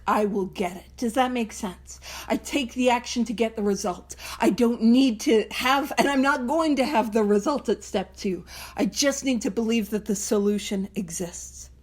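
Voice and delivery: ordinary talk, nothing marked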